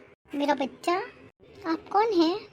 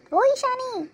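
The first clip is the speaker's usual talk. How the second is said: calling out; 8 semitones higher, slower than usual. Acted. Mother calling daughter from kitchen.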